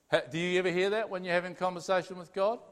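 usual speaking voice

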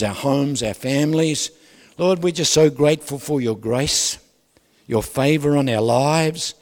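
fervent praise prayer to God; worship prayer. Acted. Leads congregation in prayer.

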